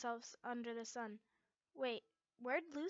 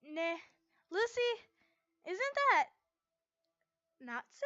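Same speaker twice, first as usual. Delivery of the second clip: excited spotting; 8 semitones higher, 10 dB louder than usual. A narrator's reading. Levy spots Natsu excitedly.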